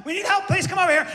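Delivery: very high, fast panicked plea; frantic call for help. Retold or imitated. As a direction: beg for help in alarm. Passengers calling for help.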